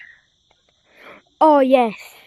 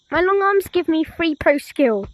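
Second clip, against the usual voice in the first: normal talking voice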